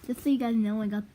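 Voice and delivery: loud, exasperated announcement. Real, not acted. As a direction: tell everyone loudly, fed up.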